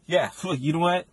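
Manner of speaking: ordinary delivery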